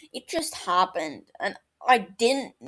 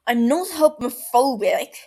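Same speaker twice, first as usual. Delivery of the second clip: louder, a defensive snap; snapping; 4 semitones higher, 7 dB louder than usual. A narrator's reading. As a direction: bark it back, stung. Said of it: "Barty snaps".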